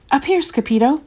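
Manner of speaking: high-pitched call from above; hollering. A narrator's reading. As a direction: holler down to a friend below. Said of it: "hollered the Chihuahuas".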